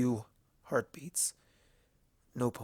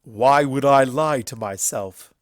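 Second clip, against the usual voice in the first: loud stallion voice; indignant frowning retort; 10 dB louder than usual. A narrator's reading. Everfree answering Applejack, loud and frowning. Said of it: "Everfree frowned".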